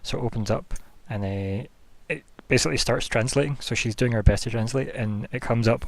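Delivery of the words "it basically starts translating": ordinary delivery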